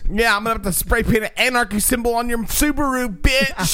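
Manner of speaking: put-on high shrill bratty teen rant; angry emo teen. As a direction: whine like a furious teenager at mom.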